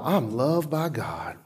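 low, quiet "I'm loved by God"; soft reassurance. Shown as a demonstration. Preacher voicing a believer's quiet answer to doubt.

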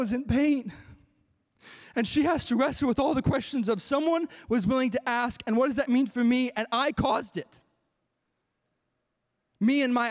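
loud, high-pitched preacher at full force; impassioned preaching. Acted. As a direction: preach it with anguished intensity, voice lifted. Impassioned preaching of breakdown story.

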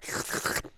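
imitated slurp of spit; sucking noise. Mimicking older brother sucking the dangling loogie back up, slurping noise. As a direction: noisily suck it back up through the mouth. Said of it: "So he would suck it back up".

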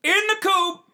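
high and loud, incredulous; high outraged exclaim. Real, not acted. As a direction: squeal it out in disbelief. Outraged punchline.

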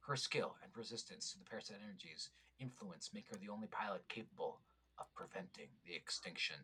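hushed epic narrator voice; old sci-fi announcer. Pretend. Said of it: "a fun voice".

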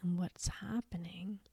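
soft, soothing meditation voice, long pauses; guided meditation. Acted. Guide leading listeners through healing creativity blocks, soft meditation voice.

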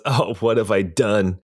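slow, understated remorseful lament; a touch of despair, drawn out slowly; mild anguish. Acted. Game master voicing the drinking rich man, mildly anguished. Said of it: "puts his head in his hands".

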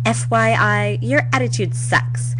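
high, emphatic mock sass; acted put-down. Teen putting down a squad rival, mock sassy. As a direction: deliver a lifted, sassy dismissal.

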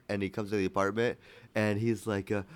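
normal talking voice

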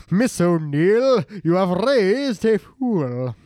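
slow, lightly hammed-up dramatic verdict; a hint of a solemn TV host, drawn out slow. Joking about a dramatic show, slow mock verdict. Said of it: "sounds like it'd be very dramatic".

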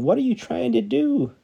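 startled shout over a bridge railing. Acted. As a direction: yell it down at the man below. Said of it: "He shouted over the railing".

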